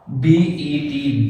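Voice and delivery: letters "बी ई टी" spelled out very slowly; slow spelling. Shown as a demonstration. Teacher slowly spelling out bet for students. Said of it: "बैट है हमारे पास".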